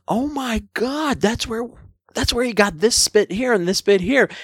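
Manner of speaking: retold sudden discovery, high and delighted; excited realization. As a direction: gasp it out as if you'd just spotted the source. Retelling his realization in the cinema.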